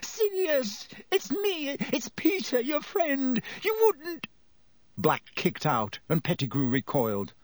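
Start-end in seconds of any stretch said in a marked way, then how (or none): 0.0-4.3 high, squeaky frightened begging; grovelling plea. A narrator's reading. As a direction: beg for your life in a high squeak. Pettigrew grovels, begging for his life.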